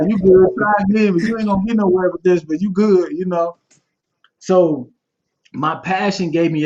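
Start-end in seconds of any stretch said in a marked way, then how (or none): 0.1-3.1 mock reassuring teacher. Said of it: "It's like".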